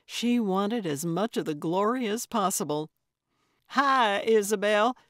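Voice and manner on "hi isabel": louder, raised greeting across a room; bright call across the store. A narrator's reading. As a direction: call out her name as you walk in. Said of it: "she called out".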